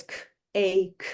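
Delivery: "k, a, k" as isolated sounds: k, long a, k; separated sounds. Shown as a demonstration. Teacher finding the sounds in cake, separated sounds. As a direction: sound out "k, a, k" one phoneme at a time, with gaps. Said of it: "They find the sounds".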